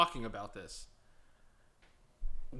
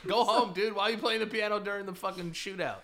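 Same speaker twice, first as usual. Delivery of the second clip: louder, higher indignant call; yelling at the pianist; 7 semitones higher, 6 dB louder than usual. Retold or imitated. Gunfighter yelling at the piano player, loud and indignant. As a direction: shout it across the saloon at the piano man. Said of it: "It's like".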